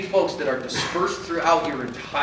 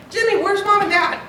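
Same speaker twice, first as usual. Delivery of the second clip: put-on high, louder call for a lost kid; calling out to child; 14 semitones higher, 4 dB louder than usual. Member calls out to a lost child, high and loud. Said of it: "I always tell my church".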